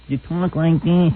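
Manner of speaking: slow, drawn-out imitation of a timid man; draggy mock of an unmanly speaker; mocking timid talk. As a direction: mimic his meek talk, dragging the words. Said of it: "it's the way you talk".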